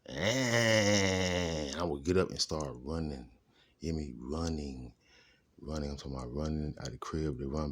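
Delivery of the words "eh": long, loud "ehhhh" wail; imitated scary sound. Demonstrating his cousin's scare noise, drawn-out loud wail. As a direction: raise the voice and hold the eerie noise. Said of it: "It's the sound he made, like a".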